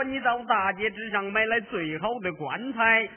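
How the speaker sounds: ordinary talk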